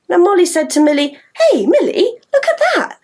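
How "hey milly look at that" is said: put-on excited girl. Molly voiced excited in story.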